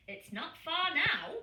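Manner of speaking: reassuring polar bear. A narrator's reading.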